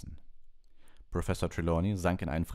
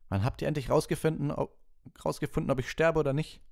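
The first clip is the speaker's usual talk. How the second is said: much higher and louder exasperated cry; outburst; 11 semitones higher, 5 dB louder than usual. A narrator's reading. Harry to the class, bursting out high and loud. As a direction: snap out at the whole class. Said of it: "rief Harry".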